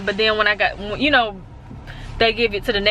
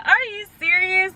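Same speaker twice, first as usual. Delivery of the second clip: disbelief; 10 semitones higher than usual. Retold or imitated. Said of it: "And I'm like".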